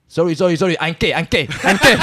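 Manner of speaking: blurting it out over and over to get out of it; retold loud declaration. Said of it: "黄汉很大声地说". (黄汉半笑着大声说自己是gay)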